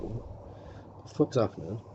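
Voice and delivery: soft, slow bewildered question; stunned murmur. Retold or imitated. Seeing the fairground, soft slow bewildered. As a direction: say it under your breath.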